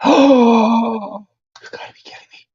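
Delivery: gasping whisper of disbelief. Real, not acted. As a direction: breathe it out in shock. Shocked at lost position.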